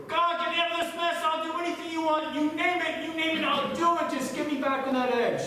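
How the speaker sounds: high, loud desperate bargaining; panicked pleading. Acted. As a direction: plead frantically for your life. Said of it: "in horror and panic".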